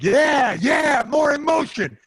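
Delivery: high, loud, slow roar of agreement; fired-up shout. Retold or imitated. Bloodied fighter roars back at his coach, loud and high. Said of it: "I was like".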